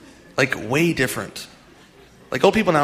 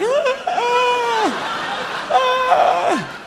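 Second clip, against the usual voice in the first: put-on very high, strained, far-off old-man wail; old driver's wail; much slower than usual. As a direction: wail like a lost, ancient driver, pitched way up. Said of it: "And they're just like".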